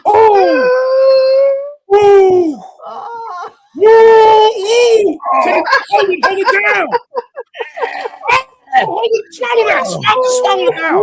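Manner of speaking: high, loud whooping cheers; cheering shout. Real, not acted. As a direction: cheer him on like a crowd. Cheering friend eating mealworm.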